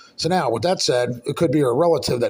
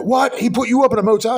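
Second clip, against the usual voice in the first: high, fast incredulous outburst; mock disbelief; 8 semitones higher, 5 dB louder than usual. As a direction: imitate her astonished question, pitched up and quick. Retelling her shocked reply.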